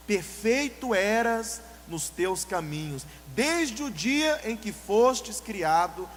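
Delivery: very slow, stretched verse; solemn scripture reading. Shown as a demonstration. Preacher rereads the verse slowly after naming the devil. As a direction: intone the verse heavily again. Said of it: "Sabe de quem".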